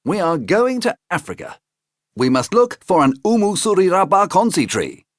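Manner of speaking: a high, eager storybook uncle; high Uncle Jack voice. Acted. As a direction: pitch up into the friendly uncle.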